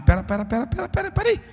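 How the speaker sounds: high, rising stop-stop; alarmed protest. Retold or imitated. Young man stops the sister in panic, pitch climbing. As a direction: cut her off in alarm.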